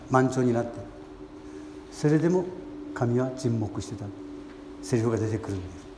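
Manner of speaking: ordinary delivery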